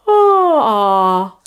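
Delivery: long yawn sliding down into an open ah. Shown as a demonstration. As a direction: start yawning, settle on a back ah. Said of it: "go from a yawn to R".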